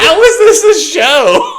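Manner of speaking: high, loud incredulous squeal; mock disbelief. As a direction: squeak it out like you can't believe it. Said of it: "I'm just like".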